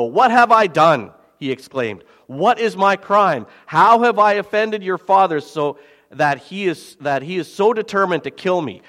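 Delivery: scripture reading. Acted. Reads David's complaint.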